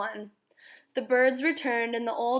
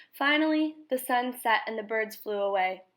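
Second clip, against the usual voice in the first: ordinary delivery, nothing marked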